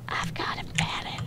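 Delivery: very soft, low whispered tune; hushed whisper. Pretend. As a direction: whisper it under your breath. Sings the snippet again, hushed.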